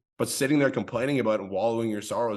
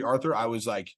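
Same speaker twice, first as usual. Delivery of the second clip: ordinary delivery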